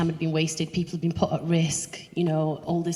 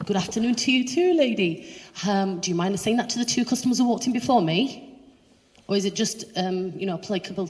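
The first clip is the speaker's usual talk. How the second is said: loud, raised-pitch mock courtesy; acted-out comeback, higher and louder; pointedly polite retort; 4 semitones higher, 3 dB louder than usual. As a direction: challenge the shop worker with icy politeness. Acts out pointed reply to worker.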